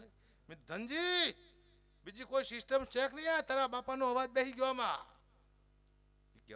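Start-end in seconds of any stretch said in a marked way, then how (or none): 0.7-5.1 high, loud call to Dhanji. Retold or imitated. Agubha calling Dhanji about his father's lost voice, loud. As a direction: shout down the phone about the voice giving out.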